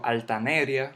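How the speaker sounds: "altaneria" stressed on NE, al-ta-NE-ria; mis-stressed "altaneria" as altaNÉria; wrong stress on NE. Shown as a demonstration. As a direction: punch NE in "altaneria", run "ria" together. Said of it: "yo no digo".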